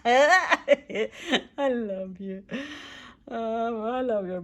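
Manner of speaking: high, loud laughing cry; real tears and giggles. Real, not acted. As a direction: let the laugh break the words. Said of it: "I'm like, I'm crying".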